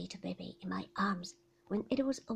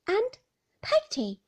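put-on boy's eager voice; 11 semitones higher, 8 dB louder than usual. A narrator's reading. Young Davy speaks to Peggotty.

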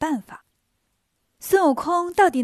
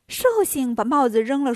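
ordinary delivery, nothing marked

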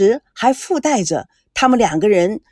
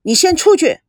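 higher and louder, an order; angry; 5 semitones higher, 5 dB louder than usual. Acted. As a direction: send her out in anger. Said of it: "赵维康生气了".

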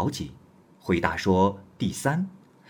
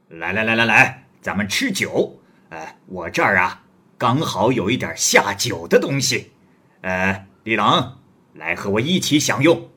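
louder, swaggering stranger voice; bold wandering hero; 5 dB louder than usual. Acted. Guest presses Li Jing about his woman, loud and swaggering. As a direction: press the young scholar like a blunt big brother. Guest offers food with wine.

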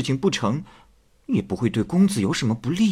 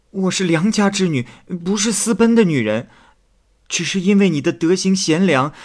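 put-on higher, louder young maid's voice, halting with hesitations; tearful maid pleading; 7 semitones higher, 4 dB louder than usual. Maid begs the scholar not to misjudge her, higher tearful plea. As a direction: plead with him, stumbling over the words. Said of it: "青梅哭着说".

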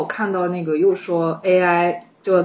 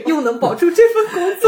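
laughing; 10 semitones higher than usual. Real, not acted. Joking about dream job.

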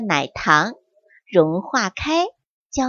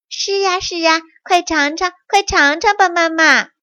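put-on higher, bright eager children's voices; happy; 6 semitones higher than usual. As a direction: urge mom to taste, bubbling. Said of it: "也一起快乐地说". (孩子们高声快乐地催促)

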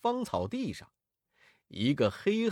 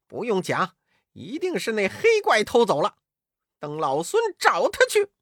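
lightly voiced Wukong, brash and louder; cocky Monkey King; 5 semitones higher, 4 dB louder than usual. A narrator's reading. Wukong tells the monks he'll catch the thief, brash boast. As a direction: boast it like a cheeky monkey hero. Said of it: "悟空笑道".

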